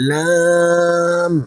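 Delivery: লাম drawn out slowly and gently; gentle long লাম in recitation; soft Lam. Shown as a demonstration. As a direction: model লাম lightly for students to repeat. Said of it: "আবার পড়ুন".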